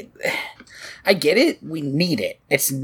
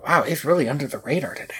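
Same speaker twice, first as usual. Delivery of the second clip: mock whisper; 3 semitones lower, 4 dB quieter, much faster than usual. Joking whisper about hidden voice actor.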